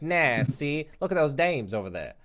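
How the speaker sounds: put-on old-time American movie-talk accent; bad fifties inflection. Podcaster reviving lost old American speech, mock fifties accent. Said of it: "bad fifties inflection".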